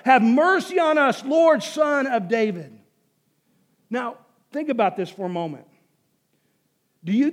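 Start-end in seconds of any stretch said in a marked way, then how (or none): 0.1-2.8 loud, raised cry that eases at the end; screamed plea. Acted. Preacher as beggars calling over the noisy crowd, shouted plea. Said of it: "scream louder and louder".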